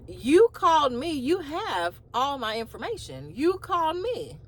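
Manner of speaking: "You called me" pushed up in disbelief; exasperated insistence. Retold or imitated. Patient to receptionist, exasperated and insistent. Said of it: "I'm like".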